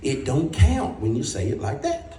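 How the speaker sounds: drawn-out motherly verdict; mama's slow rule. Retold or imitated. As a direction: mom laying down the rule to her son. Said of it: "She says".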